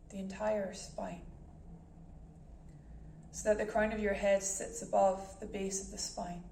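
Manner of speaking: calm guided meditation voice with long pauses. Acted. Meditation host guiding listeners to breath and body, calm and measured. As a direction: ease listeners into stillness, unhurried and even.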